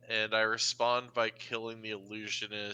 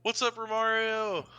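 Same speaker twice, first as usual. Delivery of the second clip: excited greeting; 12 semitones higher, 4 dB louder than usual. Real, not acted. Greeting a friend joining stream.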